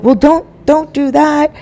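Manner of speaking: high, bleating groggy protest; feeble protest. Retold or imitated. Husband protesting as his wife ties his arms, high feeble plea. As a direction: plead weakly in a high voice.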